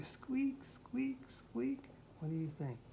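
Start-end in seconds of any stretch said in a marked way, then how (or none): 0.0-1.8 higher, softer squeaks, teasing; squeaky voice. Acted. Asking the child whether a big horse squeaks, in higher soft squeaks. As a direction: squeak it small and high. Silly guess read to child.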